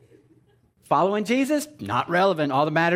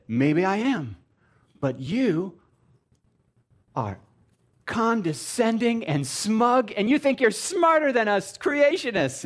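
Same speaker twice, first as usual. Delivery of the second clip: mock creationist lady; 6 semitones higher, slower than usual. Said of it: "And she said".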